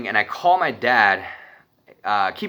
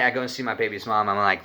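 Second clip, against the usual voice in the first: usual speaking voice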